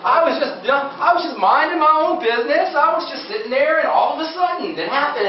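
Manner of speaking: high-pitched mock protest of innocence; mimicked innocent patient. Imitating people surprised by sudden illness, high mock-innocent voice. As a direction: play the blameless victim, pitched well up. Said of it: "they'll say to me things like".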